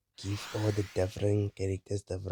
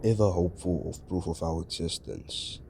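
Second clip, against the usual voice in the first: solemn celestial narrator. Acted. Game narrator's solemn lines.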